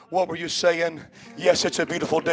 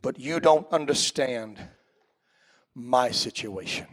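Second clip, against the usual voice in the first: imitating a weary churchgoer; 4 semitones lower, 4 dB quieter than usual. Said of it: "nobody's sitting there tonight saying".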